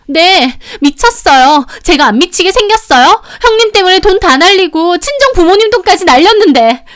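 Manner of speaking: high-pitched, loud furious tirade; angry yelling. A narrator's reading. Youngest sister-in-law blames the elder for lost money, angry yelling. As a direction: throw the blame in a high yell. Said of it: "화가 나서 소리를 지르더라고요".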